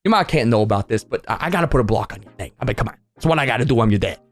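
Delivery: low, stern dad voice. Retold or imitated. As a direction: play the dad, voice dropped well down. Dad voiced in expected scene.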